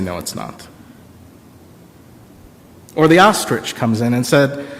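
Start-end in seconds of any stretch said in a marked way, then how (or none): 0.0-0.7 quiet flat no. Acted. Child flatly refusing.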